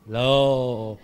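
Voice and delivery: normal talking voice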